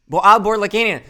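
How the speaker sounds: a flustered self-correcting exclamation; sudden exclaim. Real, not acted. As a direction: catch yourself and blurt the correction. Corrects himself, exclaiming name.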